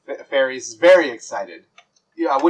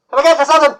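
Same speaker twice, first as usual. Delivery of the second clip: high tiny fairy voice, much higher and louder; fairy waiter; 13 semitones higher, 7 dB louder, much faster than usual. Acted. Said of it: "you hear one go".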